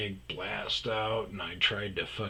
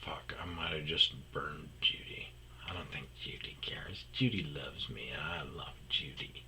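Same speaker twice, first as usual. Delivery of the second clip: whispered to himself, worried; whispered aside; 5 semitones lower, 9 dB quieter than usual. Real, not acted. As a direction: murmur it like a guilty confession. Aside, realising he exposed source.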